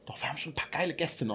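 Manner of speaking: fast hushed whisper. Real, not acted. Whispered aside about guests.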